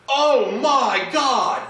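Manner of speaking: high, loud, drawn-out horrified cry; acted shocked exclamation. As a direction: mimic a scandalized churchgoer, stretching every word. Retold shocked churchgoer seeing the tattoo.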